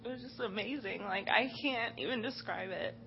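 soft, choked-up and faltering; real tears she is trying to hold in; holding back tears. Real, not acted. Worship leader describing the kids praying, softly choked up. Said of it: "I'm gonna try really hard not to cry".

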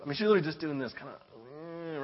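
rushed unvoiced aside; fast whisper. Pastor describing the hesitant daughter, quick whisper.

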